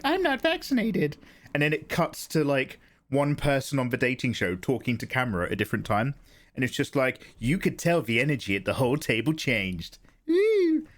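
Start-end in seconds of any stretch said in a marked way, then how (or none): 0.0-1.2 high put-on dramatic girl voice; Tanya on dating show. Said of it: "And Tanya's just like". 10.3-10.9 high loud woo like a TV sting; mock dramatic whoop. Mimics show's dramatic sting.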